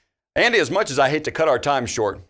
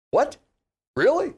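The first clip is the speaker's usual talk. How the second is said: high disbelieving really; surprised; 12 semitones higher than usual. Real, not acted. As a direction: squeak it in disbelief. Host disbelieving producer's news.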